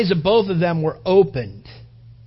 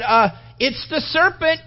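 voicing the woman; 9 semitones higher than usual. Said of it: "she said".

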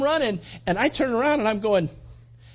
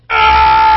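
huge charging yell at the cows; battle yell; 17 dB louder than usual. Retold or imitated. Charging the cows, a huge desperate yell. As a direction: let out a full-throated war cry. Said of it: "and I started going".

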